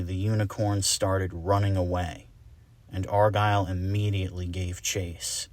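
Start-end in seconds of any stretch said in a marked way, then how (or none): none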